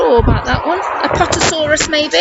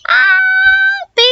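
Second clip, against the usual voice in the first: mum calling her son across the garden, high and loud; 4 dB louder than usual. Acted. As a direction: call him in from afar, much higher and louder. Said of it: "Who could be shouting him?".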